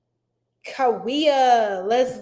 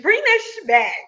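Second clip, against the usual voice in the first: higher, louder sassy retort; mock outburst; 8 semitones higher, 6 dB louder than usual. Answering the trend, a louder sassy retort. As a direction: snap back like it's obvious. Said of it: "I'm like".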